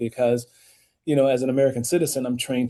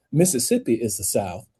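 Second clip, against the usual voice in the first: ordinary talk, nothing marked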